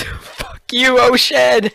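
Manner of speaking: high-pitched mock scream; character's scream. Player's character screams at the sea as ship sinks, mock scream. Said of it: "Zool screams".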